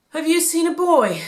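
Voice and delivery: high, urgent question; anxious father. A narrator's reading. Exhausted Joseph asks a temple priest, urgently. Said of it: "Joseph asked".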